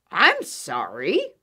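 slow, loud badger bark. A narrator's reading. Badger turning Little Mouse away, barked. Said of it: "barked Badger".

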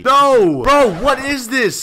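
high loud cry, then slow disbelief; shocked shout. Real, not acted. Player hugged by a clingy girl, shocked shout. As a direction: scream it as she grabs you. Girl hugs him tightly.

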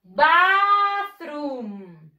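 Bathroom drawn out with stress on "bath"; model pronunciation. Shown as a demonstration. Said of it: "One more time".

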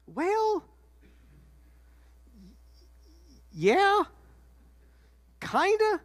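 high, stretched, squirming answers; evasive Mary. Acted. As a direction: drag each word out, dodging. Mary evading the good-or-bad question.

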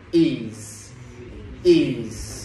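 "is" drawn out with a buzzing z, falling; clear voiced ending. Shown as a demonstration. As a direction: hold "is" slowly to a clear z. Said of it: "Phải đọc ra âm giờ rõ". (đọc "is" kéo dài, rõ âm z)

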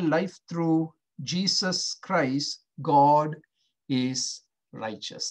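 slow, deliberate reading, every word weighed. Shown as a demonstration. Pastor reads a paragraph to the congregation, slow and deliberate. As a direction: read it out slowly so they can absorb it. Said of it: "I'm going to read it real slow".

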